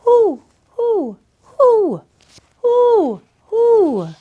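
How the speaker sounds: high hooting owl call; owl hoots. Acted. Calling to the owls with high hoots. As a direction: hoot like a great horned owl into the night.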